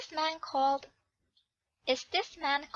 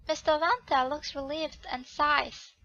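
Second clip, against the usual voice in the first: ordinary delivery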